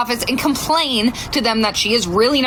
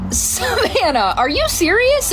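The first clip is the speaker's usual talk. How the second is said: slow on the name, then much higher in disbelief; incredulous; 8 semitones higher, slower than usual. Retold or imitated. Mom caught by the faking daughter, incredulous. Daughter suddenly feels better.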